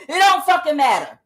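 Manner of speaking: a loud comedian catchphrase; Kevin Hart impression. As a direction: belt it out like a stand-up closer. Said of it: "Like Kevin Hart said".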